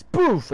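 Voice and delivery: high, loud "boof" of a landing; punchy mimicked crash noise; sudden loud thud sound effect; imitated impact boof.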